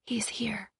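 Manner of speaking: breath-only whisper, no voice. A narrator's reading. Cress answering Thorn beside her, whispering back. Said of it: "she whispered back".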